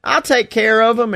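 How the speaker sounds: mock eager agreement, pitched high. AJ agrees to help Miz and Morrison, lightly mocked eagerness. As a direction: chirp it like an overly willing helper. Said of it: "and A J's like".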